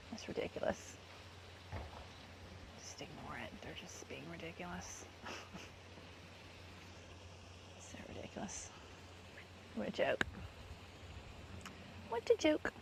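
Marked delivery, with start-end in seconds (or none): 2.8-8.7 whisper. Real, not acted. Muttering about them. 12.1-12.7 jumps much higher in mock disbelief. Real, not acted. Scoffing at the housemates, in a mocking high voice. Repeated remark, pitched up.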